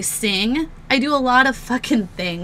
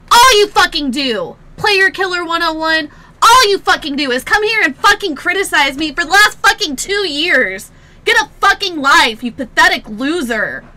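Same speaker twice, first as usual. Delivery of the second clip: furious shouted rant; angry yelling; 3 semitones higher, 8 dB louder than usual. Real, not acted. Streamer raging at a hater in chat. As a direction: keep yelling at him.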